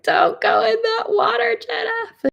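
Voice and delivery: high, loud, half-laughing wail; mock frantic warning. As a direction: cry out a hammed-up warning to yourself. Mock warning to her past self.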